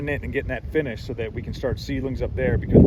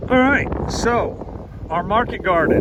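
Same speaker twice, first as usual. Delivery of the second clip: shouting over wind; 5 semitones higher, slower than usual. Real, not acted. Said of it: "this wind is insane up here".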